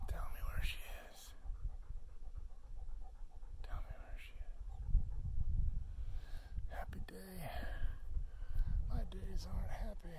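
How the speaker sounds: real whisper, barely any voice; whispered plea. Real, not acted. Owner begging the earth for her lost dog, whispered plea.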